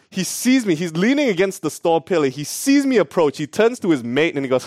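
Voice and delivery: usual speaking voice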